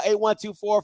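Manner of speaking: usual speaking voice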